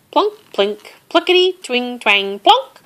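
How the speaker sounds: twanging plucks that jump high; banjo imitation. A narrator's reading. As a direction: imitate a tiny banjo with bouncy words. Imitating a banjo being strummed.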